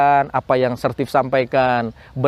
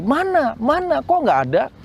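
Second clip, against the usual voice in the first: much higher, challenging mock demand; indignant where; 11 semitones higher than usual. Preacher mimicking doubters who ask where God is, indignant. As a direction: throw it out like a skeptic.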